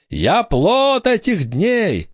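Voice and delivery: high, very slow intoning; ghostly pronouncement. A narrator's reading. Ghost girl claims pirate as father, high slow wail. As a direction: intone it like an accusing phantom.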